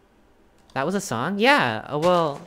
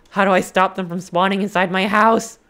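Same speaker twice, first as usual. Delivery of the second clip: worried; 4 semitones higher, 8 dB louder than usual. Real, not acted. Worried about monsters.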